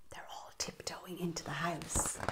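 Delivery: hushed secret whisper. Acted. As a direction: whisper as if sneaking in. Sneaking animals, whisper.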